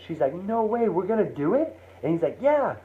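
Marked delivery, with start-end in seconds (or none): 0.3-1.8 high, thrilled disbelief; overjoyed at the news; ecstatic. Retold or imitated. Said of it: "his wife is ecstatic". 2.4-2.8 high and eager throughout; fired up telling his wife; thrilled. Retold or imitated. John assuring his wife, thrilled.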